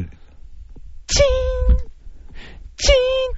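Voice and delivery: very high, soft, long "chiin"; imitated triangle ding. Imagining child practising triangle, imitated ringing. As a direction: ring out a thin high note, letting it hang. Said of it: "トライアングル".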